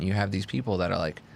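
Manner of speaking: ordinary talk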